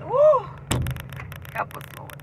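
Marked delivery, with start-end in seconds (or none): 1.5-2.2 soft whispered plea to God; whispered prayer. Real, not acted. Praying quietly for her viewers, whispered. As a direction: breathe the prayer out.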